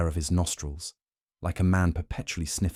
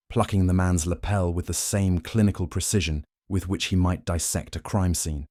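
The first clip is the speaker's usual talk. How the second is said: ordinary delivery, nothing marked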